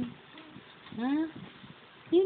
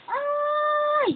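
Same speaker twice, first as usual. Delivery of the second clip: startled squeal. Real, not acted. Mother startled by the baby's outburst, squeals.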